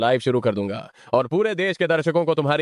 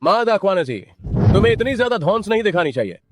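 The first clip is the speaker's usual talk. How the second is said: higher-pitched, indignant protest; indignant rebuke; 6 semitones higher, 3 dB louder than usual. Acted. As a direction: stand up to the bully, voice raised. Confronting the bullying village head.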